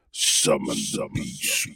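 very low, slow growl of the beast; demonic beast voice. Pretend. Joking about the number six six six, demonic low growl. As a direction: summon it like a demon from below.

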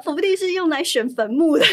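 high, loud disbelief; acted-out inner protest, emphatic and aghast; incredulous outburst. Employee silently protesting the boss's claim, loudly incredulous.

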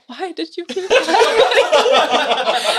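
high, drawn-out mock reproach; imitated scolding mom. Daughter retelling mom's reaction to her remark, mock-appalled. Mom's horrified question retold, amid laughter.

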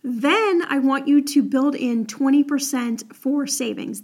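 ordinary talk

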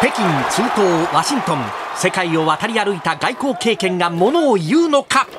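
sports commentator. Acted. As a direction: hype play-by-play voice.

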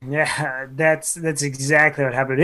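normal talking voice

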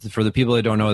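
ordinary talk

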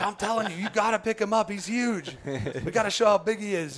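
mock guide pushing; retold eager guide; insistent urging.

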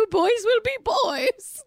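high, slow mock-dismissive voice; airy parody of an excuse; mocking Lisa Vanderpump. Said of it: "you slapped them on the wrist and said".

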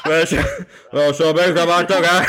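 a hint of Churchill through laughter, quick; Winston Churchill. Contestant admitting the Roy voice drifted into a Churchill, laughing. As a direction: ham up a wartime orator briefly. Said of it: "I went somehow Winston Churchill".

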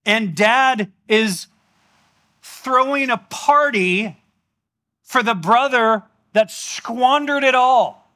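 preacher stressing the scandal slowly; building emphasis. Real, not acted. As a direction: drag it out to make the point land. Preacher emphasises father's party.

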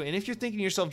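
ordinary talk, nothing marked

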